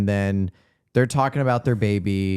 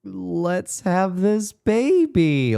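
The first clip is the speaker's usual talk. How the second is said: forced, drawn-out false eagerness, higher; lying through teeth; 6 semitones higher, much slower than usual. Retold or imitated. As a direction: stretch it out, feigning you're ready. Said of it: "lying through the skin of his teeth".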